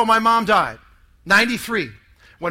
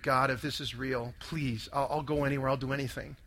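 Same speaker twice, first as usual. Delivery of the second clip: low, hushed pleading; tearful prayer, retold; 9 semitones lower, 12 dB quieter than usual. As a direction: softly recall a desperate bedside prayer. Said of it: "with tears in my eyes, I prayed".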